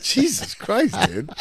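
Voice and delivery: high laughing Jesus Christ; laughing exclaim. Real, not acted. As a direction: blurt it out laughing, way up. Laughing at Tom's reply.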